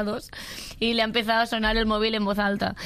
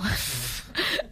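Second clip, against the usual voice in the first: low laugh; 8 semitones lower, 4 dB quieter than usual. Real, not acted. Laughing at her own confession.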